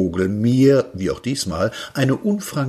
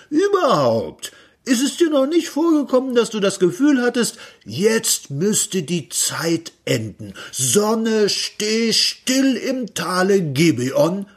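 much higher, rushing then slowing grandly; rapturous inventor; 11 semitones higher than usual. Acted. Inventor explaining stopped time to Peter, rapturous. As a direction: build to a prophetic cry.

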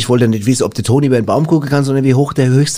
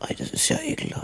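disgusted whisper; 14 dB quieter than usual. Acted.

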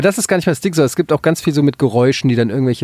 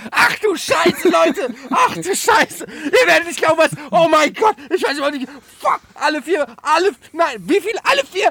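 much higher, shouting in total panic; panicking pilot; 14 semitones higher than usual. Pretend. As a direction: pilot losing it over the intercom. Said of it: "ein Pilot, der die, das Mikrofon anmacht und sagt".

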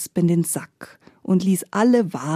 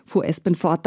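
usual speaking voice

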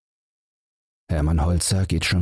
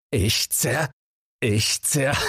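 higher, breaking off after "zer-"; broken restart; 8 semitones higher, much slower than usual. Acted. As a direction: start and stall on "zer-". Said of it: "Immer von neuem beginnt er". (Holzer setzt hoch an und bricht ab)

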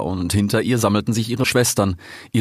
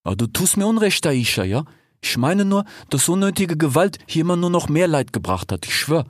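higher, quick, reasoning little fox voice; hesitant fox Fennec; 8 semitones higher than usual. A narrator's reading. Fennec argues against revolution, high doubtful voice. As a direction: plead for talking, voice pitched up.

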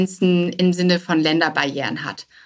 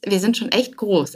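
ordinary talk

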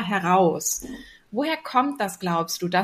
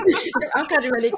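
laughing; 5 semitones higher, 3 dB louder, much faster than usual. Real, not acted. Teasing about the pool story.

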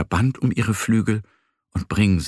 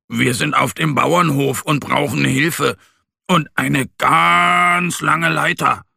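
lauter, höher, langsam und klar ins Telefon; laut und deutlich; 6 semitones higher, 4 dB louder than usual. Acted. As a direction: sprich überdeutlich zur Feuerwehr am Telefon. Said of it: "sagt laut und deutlich". (laut und deutlich am Telefon)

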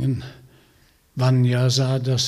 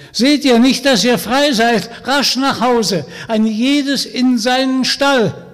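much louder and higher, urgent shouting; calling out; 9 semitones higher, 8 dB louder than usual. Acted. Vanya shouting at the horses to flee home. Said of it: "rief der starke Vanya".

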